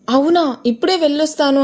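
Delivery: put-on bright, lifted reply of a pleased girl; a touch of eager joy; happy. A narrator's reading. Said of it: "ఆనందంగా".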